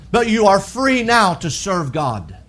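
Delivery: ordinary talk, nothing marked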